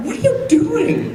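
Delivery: high-pitched incredulous question through laughter; squeaky, laughing mock question; acted laughing disbelief. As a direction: mimic someone cracking up at the sight. Acted quote told through laughter.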